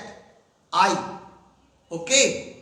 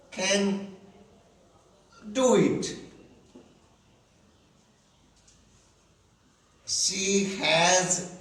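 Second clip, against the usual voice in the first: slow dictation pace; slower than usual. Shown as a demonstration. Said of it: "When I say".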